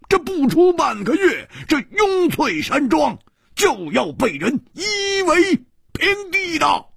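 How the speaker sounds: high-pitched, furious roar of threat; angry roar. A narrator's reading. Hu Tiehua warns those who may have killed his friend, roaring. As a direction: roar a threat at your captors. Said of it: "胡铁花大声地吼着".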